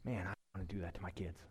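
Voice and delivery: usual speaking voice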